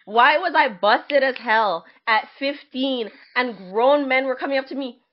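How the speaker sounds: ordinary delivery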